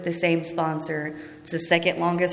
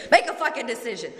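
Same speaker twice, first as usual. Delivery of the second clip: higher, fast, with a laugh breaking through; laughing sponsor quote; 6 semitones higher, faster than usual. Retold or imitated. Retelling her sponsor's blunt order, laughing through it. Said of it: "that's what she said to me".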